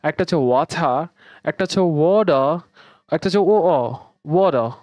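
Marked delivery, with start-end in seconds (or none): 0.4-1.0 British ওয়াটার with airy t, no final r; British accent. Shown as a demonstration. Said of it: "British accent". 1.8-2.6 American accent. Shown as a demonstration. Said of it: "American অ্যাকসেন্টে". 3.4-4.0 British glottal "wo-o", t dropped; British accent. Shown as a demonstration. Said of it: "British অ্যাকসেন্টে ওয়াটার এবং ও". 4.2-4.7 American accent. Shown as a demonstration. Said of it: "American অ্যাকসেন্টে".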